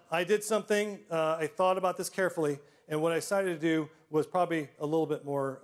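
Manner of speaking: ordinary talk, nothing marked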